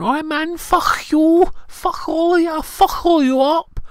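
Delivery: high, loud mock Liverpool yob; loud, high Scouse imitation. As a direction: ham up a Liverpool lad, shouting. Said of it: "he came back going".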